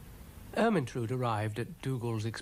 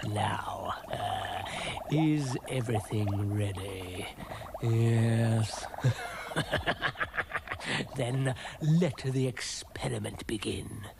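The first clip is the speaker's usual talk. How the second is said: slow, hammy mad-scientist villain; villain relishing each word between cackles; gloating mad scientist; slower than usual. Acted. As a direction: gloat slowly over your diabolical plan. Dougal plays mad scientist.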